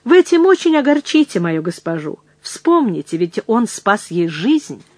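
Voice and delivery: ordinary delivery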